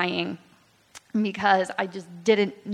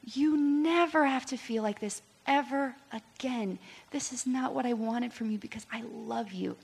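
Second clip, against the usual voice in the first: a little higher and gentler; tender voice of God; 4 semitones higher, 3 dB quieter than usual. Retold or imitated. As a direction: speak as a loving father would. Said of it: "He's like".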